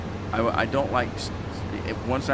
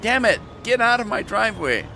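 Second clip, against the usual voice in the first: higher, louder get-off-my-lawn grump; cranky old man; 11 semitones higher, 5 dB louder than usual. Pretend. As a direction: bark it like an angry old neighbour. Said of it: "at least if I do that, people think I'm just joking".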